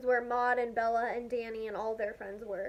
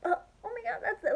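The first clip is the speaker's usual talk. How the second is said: starstruck inner voice; 8 semitones higher, much faster than usual. Retold or imitated. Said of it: "like, in my head".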